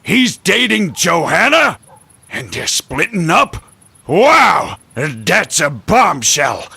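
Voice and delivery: high, shouted amazement at juicy gossip; worked-up gossip lover, loud and high; overexcited. Acted. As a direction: shout it, astonished and thrilled. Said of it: "Calm down, man".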